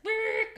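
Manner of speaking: loud, high trilled horn blast; high buzzing blast; imitated start horn. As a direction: trill it like the race starting signal. Imitating race start signal.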